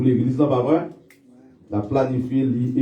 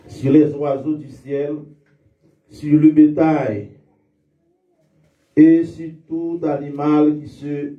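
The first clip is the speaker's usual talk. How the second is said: lit l'Écriture lentement, avec solennité; lecture biblique. Acted. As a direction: proclamer le texte sacré à l'assemblée. Lecture de la Genèse. (lecture solennelle de la Bible)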